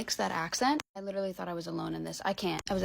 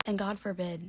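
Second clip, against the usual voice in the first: usual speaking voice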